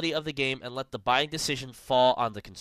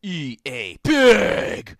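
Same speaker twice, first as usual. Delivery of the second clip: mock jab; 4 semitones higher, 9 dB louder, much slower than usual. Joke on similar company name.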